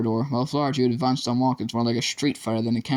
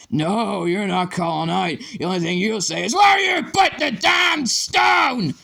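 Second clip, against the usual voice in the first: higher and rising to a slow, loud roar; building to a shout; 9 semitones higher, 4 dB louder, slower than usual. A narrator's reading. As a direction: start hard, end screaming the demand. Teacher silencing and threatening pupil.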